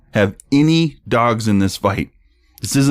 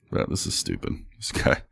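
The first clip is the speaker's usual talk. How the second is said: muttered under breath; 13 semitones lower, 11 dB quieter than usual. Real, not acted. Muttered aside after calling him dumb.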